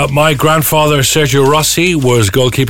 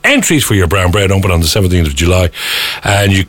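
radio ad read; 5 semitones lower than usual. Acted. Sponsor read on air.

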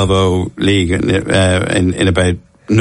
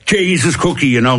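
put-on old Dublin rugby coach; 6 semitones higher than usual. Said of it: "men who kind of spoke like that".

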